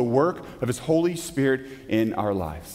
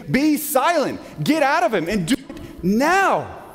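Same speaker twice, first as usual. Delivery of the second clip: high, emphatic order, slowing hard on the last word; forceful, ferocious command; 9 semitones higher than usual. Acted. Jesus commanding an unclean spirit to leave, forceful and ferocious. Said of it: "I hear force and ferocity".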